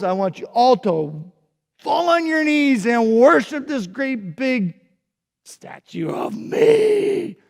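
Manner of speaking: loud, high, grand proclamation; boastful king. Acted. King to his leaders, pompous royal decree. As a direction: puff up like a vain tyrant king. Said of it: "and he said".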